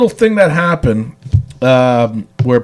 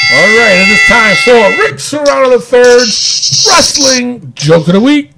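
show announcer; 6 semitones higher, 4 dB louder than usual. Acted. Introduces the show segment.

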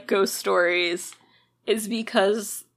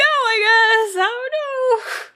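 very high, drawn-out doubtful squeal; comically high, stretched-out hedging; squeaky uncertainty; 13 semitones higher, 4 dB louder, much slower than usual. Real, not acted. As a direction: let the voice climb way up, unsure. Uneasy joke about living there.

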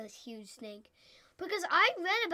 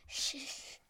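snake hiss; 7 dB quieter than usual. Pretend.

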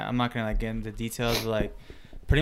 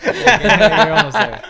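slow loud laughing tease; teasing laugh; 10 semitones higher, 14 dB louder, much slower than usual. Real, not acted. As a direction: laugh at him while cheering him on. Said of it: "him trying to keep up".

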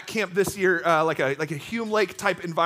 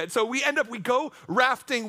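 normal talking voice

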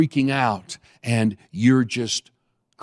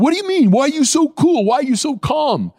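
much higher, louder baffled questions; mock astonished passenger; 4 dB louder than usual. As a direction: play the stunned man asking why you are calm. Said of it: "he looked at me and go".

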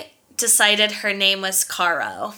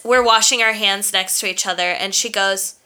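normal talking voice

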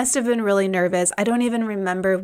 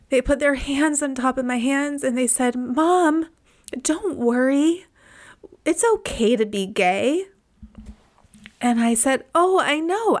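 crying; 4 semitones higher than usual. Real, not acted. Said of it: "I was crying so much".

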